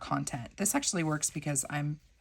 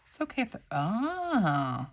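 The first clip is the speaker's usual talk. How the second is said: ordinary talk, nothing marked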